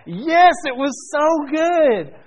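loud, high, slow mock enthusiasm; preacher hamming up his own eager reply; excited agreement. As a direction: gush it back, hammed up.

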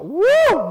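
very high, loud whoop; hammed-up holler of amazement; amazed whoop. Dad watches his son run, amazed whoop.

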